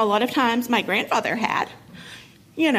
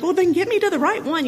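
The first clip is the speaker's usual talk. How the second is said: high, louder desperate yell at the operator; frantic yelling; 8 semitones higher, 3 dB louder than usual. Retold or imitated. Mother yelling at the wrong 911 operator, panicked high shout. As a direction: demand it through panic, pitched up high. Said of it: "I'm screaming and I'm crying".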